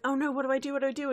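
high, fretful dither; mock panic. Recapper plays a sneak spotted by a guard, mock panic. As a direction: flap in playful alarm.

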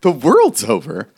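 squeaking way up on what; a disbelieving yelp, then half-laughing; high incredulous squeak at the news; stunned Tobin. Acted.

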